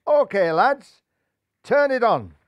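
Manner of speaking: loud call to the lads. A narrator's reading. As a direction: shout up to the crowd. Jeff calls to supporters.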